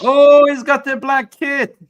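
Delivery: delighted shout at what he sees; excited exclaim. Real, not acted. Reacting to caller's outfit.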